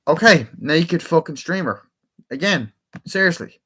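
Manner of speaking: fed up, calling out an intruder; exasperated outburst at an interruption; real exasperation. Real, not acted. Interrupted by an unwanted stream intruder.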